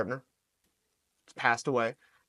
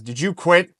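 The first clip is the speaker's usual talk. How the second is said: needling question, higher and louder; goading coach; 6 semitones higher, 11 dB louder than usual. Retold or imitated. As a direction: imitate a coach riling up his athlete. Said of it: "he would text me, he goes".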